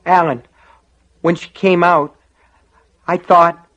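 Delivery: high, loud, halting explanation; shaken, distressed. Acted. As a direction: falter between phrases, still rattled. Shaken officer tries to explain himself.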